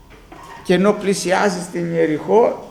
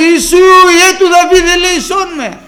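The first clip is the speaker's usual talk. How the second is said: Βαρτίμιος crying out; 10 semitones higher, 9 dB louder than usual. Acted. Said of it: "Φωνάζει".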